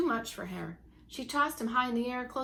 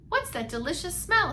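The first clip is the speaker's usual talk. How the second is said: lightly voiced father; 8 semitones higher, 5 dB louder than usual.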